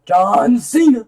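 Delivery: high, loud, drawn-out entrance call; mock wrestling announcer. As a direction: holler it like a ring announcer.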